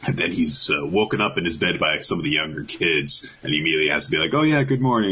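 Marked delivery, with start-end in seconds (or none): 4.3-5.1 high, put-on casual morning greeting; pretend normal. Woken by younger kids after a nightmare, pretending nothing's wrong. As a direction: cover up with a higher, breezy hello. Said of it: "pretend like nothing's wrong".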